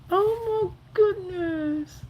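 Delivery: high, slow, tear-choked oh my goodness; almost crying with joy; tearful. Real, not acted. Overcome seeing supporter again.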